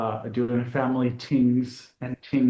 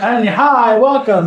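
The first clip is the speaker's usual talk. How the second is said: excited; 9 semitones higher, 10 dB louder, slower than usual. Real, not acted. Show intro greeting.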